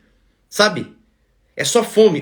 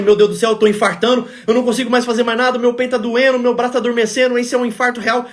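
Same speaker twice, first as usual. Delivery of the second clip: building panic. Retold or imitated. Patient convinced he is having a heart attack, retold panic. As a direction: imitate a man spiraling into panic, pitch creeping up.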